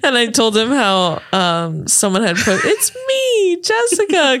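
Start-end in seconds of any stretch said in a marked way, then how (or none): 2.6-4.4 very high put-on voice; mock high-pitched announcement; high movie impression. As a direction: pipe it up high like the character. Quoting a movie line to friend.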